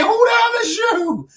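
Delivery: cracking shout of laughing disbelief; mock outraged shout. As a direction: yell it in high-pitched disbelief.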